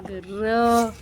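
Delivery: low imitated dog growl; mock growl. Owner growling at dog in play, low mock growl. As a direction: growl back at the pet playfully.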